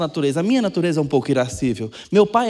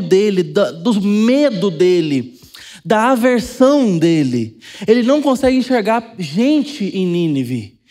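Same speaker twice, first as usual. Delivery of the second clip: full-force preaching; 5 semitones higher, 5 dB louder than usual. Acted. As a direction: build it up from the pulpit like a revival preacher. (pregação inflamada, alta e lenta)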